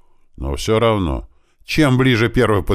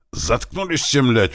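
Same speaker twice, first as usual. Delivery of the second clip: growling; 8 semitones higher, slower than usual. A narrator's reading. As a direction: bark it out like a near-growl. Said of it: "вновь практически зарычал Туман".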